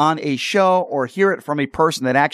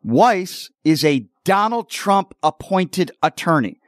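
slow and deliberate, a bit higher, each word spelled out; said slowly; 4 semitones higher, slower than usual. Real, not acted. Host spelling it out for MAGA viewers, slow and pointed. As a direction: lay it out word by word, like talking to a slow listener. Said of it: "I'm gonna say this slowly".

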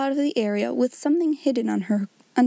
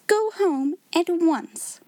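higher and slower, repeated with more force; sharper indignant command; 7 semitones higher, much slower than usual. A narrator's reading. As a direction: insist harder, very slowly. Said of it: "she commanded indignantly".

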